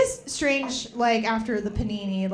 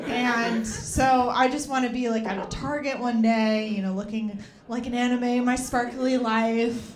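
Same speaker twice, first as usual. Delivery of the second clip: usual speaking voice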